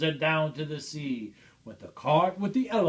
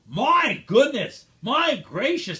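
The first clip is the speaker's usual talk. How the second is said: amazed shouting; 6 semitones higher, 6 dB louder than usual. A narrator's reading. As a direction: gasp in wonder as the crowd. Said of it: "they shouted".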